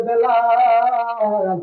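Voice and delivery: long, drawn-out chanted call. Acted. Prophet calling to Bilal in Syria, long melodic call. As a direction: call the name, stretching the last vowel. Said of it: "ডাক দিয়ে কইরে".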